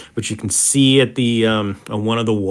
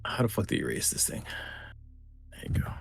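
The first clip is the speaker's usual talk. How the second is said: muttering aside; 7 semitones lower, 17 dB quieter, much faster than usual. Real, not acted.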